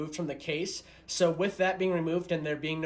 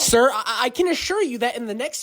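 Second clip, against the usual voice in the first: high, loud reassurance; frantic placating; 9 semitones higher, 5 dB louder than usual. Acted. Server promises waffles to angry father, frantic placating. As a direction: plead over him in a high voice. Worker placates screaming customer.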